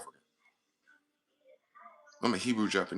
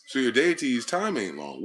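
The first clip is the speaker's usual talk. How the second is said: slow emphatic punchline; 4 semitones higher, 6 dB louder than usual. Real, not acted. Punchline after the ooh.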